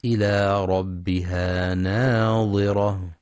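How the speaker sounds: slow chant, ناظرة with the ظ sound; with dha. Shown as a demonstration. Teacher chanting the correct second word, slow. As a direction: recite slowly with the emphatic dha. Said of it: "أما الكلمة الثانية ناظرة فهي بالظاء".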